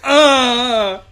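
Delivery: mock anguish. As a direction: wail it slowly in mock anguish. Horror at the scene.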